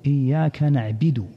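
نعبد said "na'bidu", with an i after the ba; kasra on the ba. Shown as a demonstration. Teacher imitating how common people read it, نعبد as "na'bidu". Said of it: "بكسر الباء".

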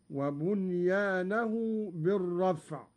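normal talking voice

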